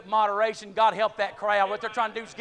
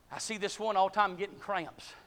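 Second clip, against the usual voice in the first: ordinary talk, nothing marked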